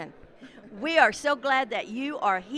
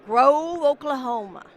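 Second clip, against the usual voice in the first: loud echo; 4 semitones higher, 10 dB louder than usual. Real, not acted. Host loudly echoes guest's hometown.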